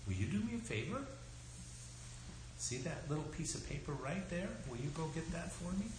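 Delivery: sweet, quiet request. Real, not acted. Asks a small child a favor.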